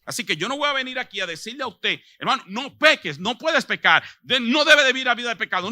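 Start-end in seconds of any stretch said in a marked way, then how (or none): 2.2-5.7 mock admonishing. Mimics a preacher scolding against sin.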